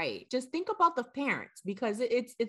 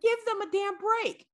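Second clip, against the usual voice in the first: very high, insistent outburst; exasperated scolding; 12 semitones higher than usual. Real, not acted. Friend defending new parents from visitors, exasperated and high. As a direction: plead and scold at a high pitch.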